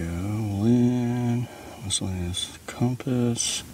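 usual speaking voice

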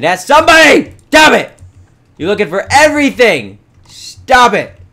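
high, exasperated yell. Pretend. Gamer to the animal who went through portal, exasperated yelling. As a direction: blow up at the animals, loud and high. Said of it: "I'm gonna stop yelling".

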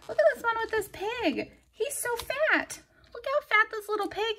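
gushing over cute pig. Real, not acted. Planner vlogger cooing high over a fat pig sticker.

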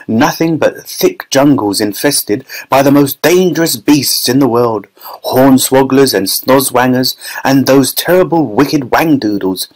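usual speaking voice

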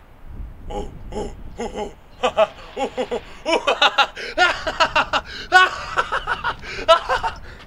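loud, high cracking-up laugh; real burst of laughter. Real, not acted. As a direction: crack up at your own joke, loud and high. Laughs at own joke.

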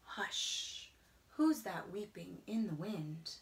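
ordinary delivery

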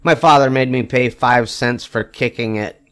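usual speaking voice

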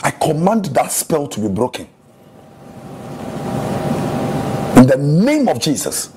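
fervent sermon to the church; preaching. Acted. Pastor preaching to his church, fervent. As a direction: preach it out to the congregation.

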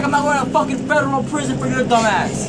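heated, high-pitched rant; getting mad. Real, not acted. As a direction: throw it back in angry disbelief. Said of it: "why you getting mad though".

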